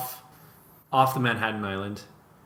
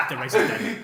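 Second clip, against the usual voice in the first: high laughing voice; 8 semitones higher than usual. Pretend. Laughing emperor joke.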